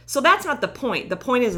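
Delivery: ordinary delivery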